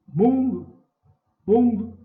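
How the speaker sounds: MUN high and very loud in mundo; calling out. Shown as a demonstration. Teacher marking the tonic syllable, stressed MUN in mundo. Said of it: "chamar ela mais forte".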